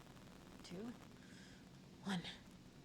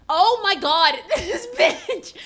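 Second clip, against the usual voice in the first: loud high laughing outburst; amused outrage, laughing; laughing shout; 9 semitones higher, 11 dB louder, much slower than usual. Real, not acted. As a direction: shriek it through laughter. Shocked reaction to game.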